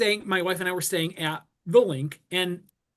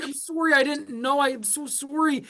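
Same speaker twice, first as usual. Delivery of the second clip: put-on Canadian accent; 6 semitones higher than usual. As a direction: meekly apologize over and over like a sweet Canadian. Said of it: "so cute Canadian accent".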